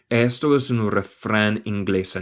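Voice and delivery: normal talking voice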